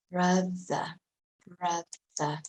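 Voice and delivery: "brother" ending in a soft uh, no r; British pronunciation. Shown as a demonstration. Teacher modelling brother, British pronunciation. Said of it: "British pronunciation".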